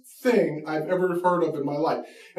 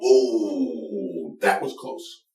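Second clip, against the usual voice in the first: a much lower, breathy "Ooh" trailing into an unvoiced "that was close"; mock fan reaction; 12 semitones lower, much slower than usual. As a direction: play the awed soccer crowd, half-mocking. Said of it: "but everybody went".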